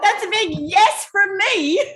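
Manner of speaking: loud, high, jubilant acceptance; excited shout. Real, not acted. Bridesmaid accepting her friend's wedding request, excited shout. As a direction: shout a big delighted yes.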